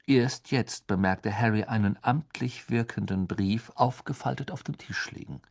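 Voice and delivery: ordinary delivery, nothing marked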